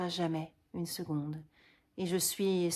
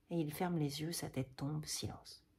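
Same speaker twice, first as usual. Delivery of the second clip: fading from soft to a breathless hush; 2 semitones lower, 7 dB quieter than usual. Acted. Dying Cyrano fading into silence, voice sinking to nothing. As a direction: let the voice die away to nothing.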